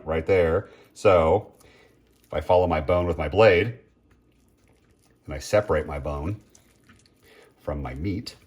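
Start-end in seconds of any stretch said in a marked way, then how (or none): none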